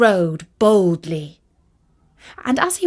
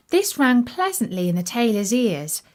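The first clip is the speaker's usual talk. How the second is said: ordinary delivery, nothing marked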